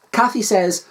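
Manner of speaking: plain reading voice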